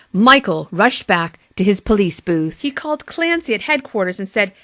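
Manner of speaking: plain reading voice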